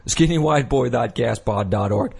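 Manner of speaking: ordinary delivery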